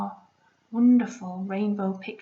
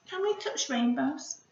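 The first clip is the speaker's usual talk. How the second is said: ordinary delivery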